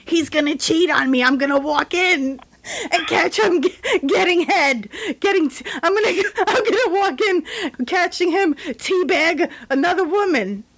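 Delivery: lightly raspy mock celebrity; hint of a husky starlet, laughing; raspy Demi Moore voice. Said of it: "she talked with that raspy voice".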